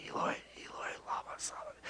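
barely audible whisper; under the breath. Shown as a demonstration. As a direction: whisper it quietly to yourself. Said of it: "say it quietly under his breath".

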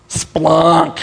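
"splunk" held long and slow; drawn-out funny word. Shown as a demonstration. Preacher enjoying funny Greek, "splunk" drawn out. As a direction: relish the sound of "splunk".

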